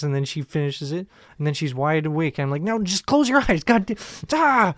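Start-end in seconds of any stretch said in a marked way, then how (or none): 2.6-4.7 high, exasperated retold outburst; frazzled parent losing it, ending in a cry; freaking out. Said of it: "And I'm freaking out".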